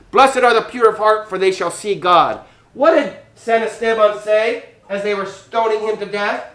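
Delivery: normal talking voice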